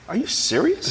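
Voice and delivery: high-pitched disbelieving question; incredulous. Retold or imitated. Addict answering a dealer who urges him on, high incredulous question. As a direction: ask it high, in retold disbelief.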